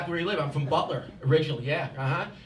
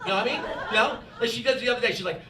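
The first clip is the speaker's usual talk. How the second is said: normal talking voice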